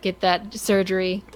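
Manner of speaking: usual speaking voice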